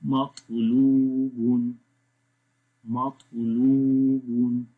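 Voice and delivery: "मतलूबुन" slow, "लू" held long and strong; long laam. Shown as a demonstration. Teacher shows why laam lengthens, "मतलूबुन" with long "लू". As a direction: draw out "लू" in the middle of "मतलूबुन". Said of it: "इसलिए लाम को लंबा करें".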